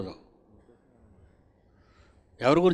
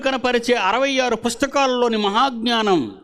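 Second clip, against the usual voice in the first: higher, louder verse proclamation; fervent scripture preaching; 10 semitones higher, 5 dB louder than usual. Acted. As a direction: echo each verse to the flock with fire. Repeating verse after reader, fervent. (ఉద్రేకంతో వాక్య ప్రకటన)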